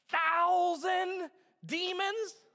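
THOUSAND drawn out high and loud; emphatic. Real, not acted. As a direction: stretch "thousand" slowly for impact. Preacher stressing the shocking number.

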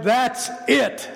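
high-pitched exasperated outburst; frustrated cry. Retold or imitated. Mother at the end of her rope over her daughter, frustrated cry. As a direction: imitate someone who has had enough. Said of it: "through her tears and her frustration, she cried".

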